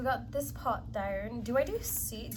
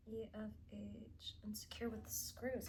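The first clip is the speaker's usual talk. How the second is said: whisper; 9 dB quieter than usual. Real, not acted. Whispering the instructions to herself while assembling. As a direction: whisper the parts to yourself. Reading instructions under breath.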